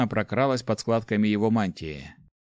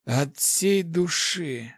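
bloody mouth; 6 semitones higher, much slower than usual. A narrator's reading. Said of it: "булькая кровью".

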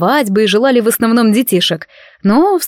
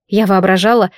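ordinary delivery, nothing marked

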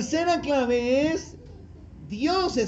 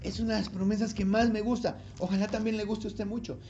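ordinary talk, nothing marked

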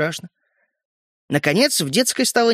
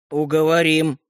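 drawn-out, mild threat; menacing; 2 semitones lower than usual. A narrator's reading. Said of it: "грозно сказал Родька". (грозно)

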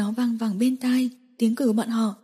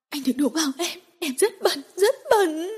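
rising high, sobbing outburst; tearful cry; 7 semitones higher than usual. A narrator's reading. Woman pushing him away, tearful high cry. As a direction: break into a crying wail. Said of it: "Diệu Tinh hét lên".

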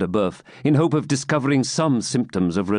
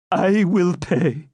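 put-on high, slow, broken old man; 9 semitones higher, much slower than usual. Captive giving in to the baron, broken plea. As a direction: give in with a cracked voice. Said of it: "he said".

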